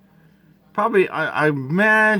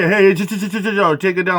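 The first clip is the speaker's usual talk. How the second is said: high, rapid-fire stuttered chills; mock chill-out shusher; 3 semitones higher, faster than usual. Imitating someone telling him to calm down, mocking. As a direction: mimic a pestering calmer-down. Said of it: "somebody come and say".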